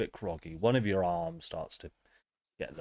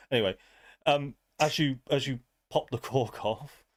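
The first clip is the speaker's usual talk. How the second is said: ordinary talk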